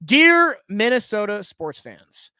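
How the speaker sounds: long, loud, drawn-out salutation; mock-solemn letter reading. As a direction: open the apology letter grandly, stretching it slowly. Opens his written apology letter.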